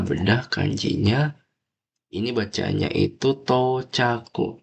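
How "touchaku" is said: TOCHAKU with the first "to" held long; long vowel. Shown as a demonstration. Teacher reads a new Japanese word for students, long vowel. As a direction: stretch the "to" in TOCHAKU into "tou". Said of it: "tounya panjang".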